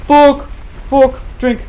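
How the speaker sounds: loud, high angry curse; furious shout. Real, not acted. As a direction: yell it out in real frustration. Boss fight going wrong.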